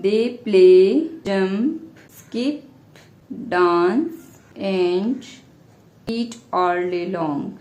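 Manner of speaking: slow dictation with long pauses; word by word, leaving time to write; dictating. Real, not acted. Teacher giving students the answer to write, dictating slowly.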